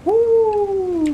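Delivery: high cheering woo; excited whoop. Real, not acted. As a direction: whoop with joy. Cheering during the game.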